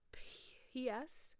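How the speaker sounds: whispered aside. Real, not acted. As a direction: breathe it like a secret.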